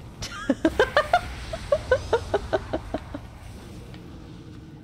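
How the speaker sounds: long, high laughing fit; good hearty laugh. Real, not acted. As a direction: crack up until the breath runs out. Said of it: "I had a good laugh".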